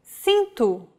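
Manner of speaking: cinto with the C as an S; soft C. Shown as a demonstration. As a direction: say cinto starting on an S sound. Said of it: "or like this".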